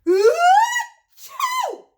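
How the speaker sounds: very loud, high "ah-choo"; explosive mock sneeze. Gerald sneezes at the thought of pigs, explosive mock sneeze. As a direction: blast out a huge sneeze.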